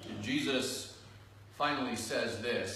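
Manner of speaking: normal talking voice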